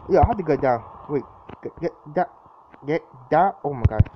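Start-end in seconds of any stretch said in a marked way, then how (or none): none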